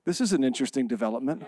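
low, soft, quick understatement; poker-faced. Retold or imitated. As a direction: play it cool, giving nothing away. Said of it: "I'm a pretty good poker player".

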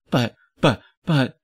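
high, punchy stammer; flustered sputtering. Acted. Hildy finds the vault completely empty, flustered sputtering. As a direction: sputter the same word in disbelief. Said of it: "You sound like a motorboat".

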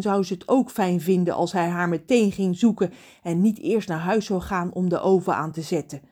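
ordinary delivery, nothing marked

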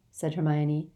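usual speaking voice

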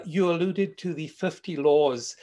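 ordinary talk

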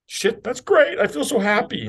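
playfully overjoyed squeal of delight; mock excitement. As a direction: ham up being thrilled with a sudden bonus. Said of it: "I'd be like".

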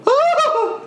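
very high-pitched mock scream; comic scream. Dwarves fleeing a spell, comic high scream. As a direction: squeal in hammed-up panic. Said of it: "you hear them all go".

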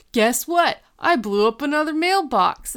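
high, eager imitation of a boy; excited announcement. Sean coming through the door, excited mock announcement. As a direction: burst in with proud news.

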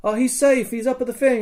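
high, projected retold reply; imitated hurried reassurance. Sheriff's friend answers the searcher, high imitated call.

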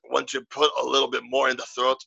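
Scrooge McDuck style Scottish burr; throaty Scottish accent. Shown as a demonstration. Showing how Scottish differs from Irish, throaty Scottish accent. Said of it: "you get that Scottish".

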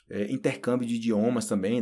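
normal talking voice

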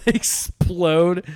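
laughing while saying explode. Real, not acted. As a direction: blurt it out through laughter. Laughing reacting to firework story.

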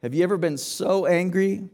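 normal talking voice

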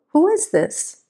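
lightly voiced wife, pitched high and wary; wife answering a silent caller, high and wary; Kathy Ann on the phone. A narrator's reading. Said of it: "as she repeated".

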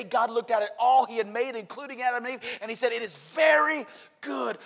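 rising preaching. Acted. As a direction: build up to "very good".

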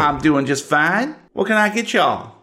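high drawling waitress voice; waitress Wilma. Acted. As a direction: greet them like a folksy old diner waitress. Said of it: "that's who waits on you".

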